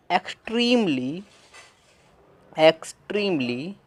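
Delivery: extremely stretched out slowly; drawn-out extremely, sounding out eks-treem-lee; slow demo word. Shown as a demonstration. Teacher sounding out extremely slowly for students. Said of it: "slow demo of extremely".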